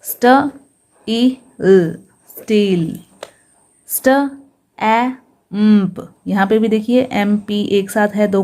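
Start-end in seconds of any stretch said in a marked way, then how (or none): 0.0-2.0 "स्ट ई ल" as separate sounds with pauses; sounded out. Shown as a demonstration. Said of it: "एसटी को क्या पढ़ा जाता है". 3.9-6.0 "स्ट ए म्प" very slowly, held hummed m before p; sounded out. Shown as a demonstration. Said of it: "एम को आधा पढ़ेंगे और पी को पूरा पढ़ेंगे".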